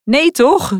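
high, fake-concerned gasp; amused husband teasing the son; mock sympathy. A narrator's reading. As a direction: pretend to feel sorry while holding back a laugh. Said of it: "quasi meelevend".

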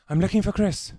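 put-on boy's voice, high and loud, panting; a winded kid blurting it out; breathless boy. A narrator's reading. Boy at the door asking for Chris, high and urgent.

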